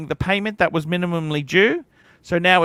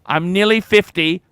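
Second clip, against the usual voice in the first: ordinary talk, nothing marked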